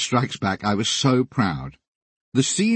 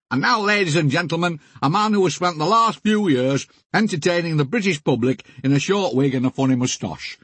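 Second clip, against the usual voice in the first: Northern English compere voice, pitched up; northern accent; 7 semitones higher than usual. Retold or imitated. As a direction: announce it in a broad northern accent. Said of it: "send me up in an impeccable northern accent".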